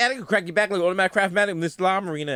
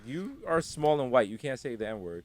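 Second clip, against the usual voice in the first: 1 low deadpan; 8 semitones lower, 8 dB quieter than usual. Retold or imitated. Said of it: "I was like".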